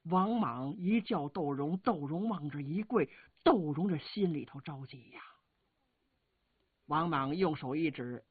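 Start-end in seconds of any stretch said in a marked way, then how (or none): none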